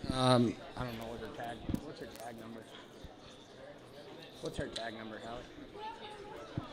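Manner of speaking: turned away from the microphone, faint; away from mic. Real, not acted. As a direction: call off-mic to a helper. Asks helper off-mic mid-auction.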